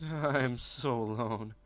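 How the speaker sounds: slow, drawn-out self-pity; mock lonely. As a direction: stretch it out as a slow lament.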